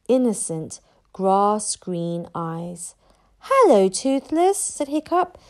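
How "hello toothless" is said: higher, louder hello to a small creature; careful greeting. A narrator's reading. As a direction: greet it hopefully, pitched up. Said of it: "what he hoped was a good accent".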